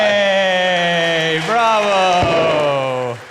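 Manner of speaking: loud drawn-out bravo; long cheering shout. Real, not acted. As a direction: bellow each word and hold it. Host cheers the contestant's accent attempt.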